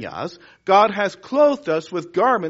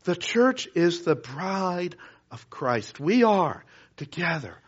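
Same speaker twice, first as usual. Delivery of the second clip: soft, lower sermon, fading to near whisper; hushed preaching; 3 semitones lower, 5 dB quieter than usual. Acted. Preacher closing tenderly to the church, hushed and soft. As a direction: draw them in quietly. Preacher drops to intimate close.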